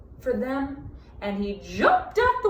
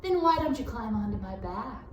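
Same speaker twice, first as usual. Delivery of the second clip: sly fox; 2 semitones lower than usual. A narrator's reading. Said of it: "asked the fox".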